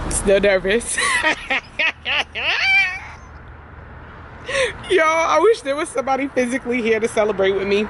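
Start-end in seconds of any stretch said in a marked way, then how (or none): 1.0-3.4 shrieking giddy laugh; real burst of joyful squealing; high excited squeal-laugh. Real, not acted. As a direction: let out a high delighted scream of laughter. Arriving at bank, thrilled.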